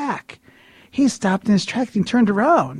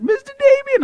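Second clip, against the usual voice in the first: put-on very high, louder eager old man; 5 dB louder than usual. Older friend tugging the preacher's shirt, high insistent squeal. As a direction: pester him in a loud squeal. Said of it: "Ed's an older gentleman".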